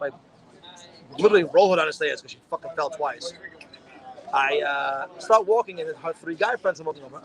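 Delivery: ordinary delivery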